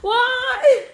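high, loud wail; mock outraged cry. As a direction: throw it out in mock despair.

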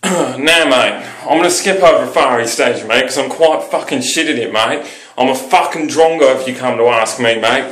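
broad, matey Aussie; sweary Australian banter; Australian accent. Shown as a demonstration. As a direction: put on a rough-and-ready Australian accent. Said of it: "Kiwi's actually more convincing".

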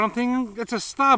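ordinary delivery

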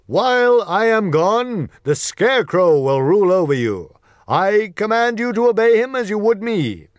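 high, loud royal proclamation from the basket; loud commanding voice. Acted. Wizard addressing his people, loud and commanding. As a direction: boom it out like a ruler's decree. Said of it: "said to all the people in a loud voice".

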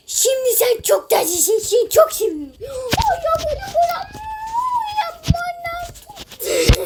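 high, loud cooing; squealing with delight; gushing squeal. Real, not acted. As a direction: swoon over something adorable. Gushing over cute avatar.

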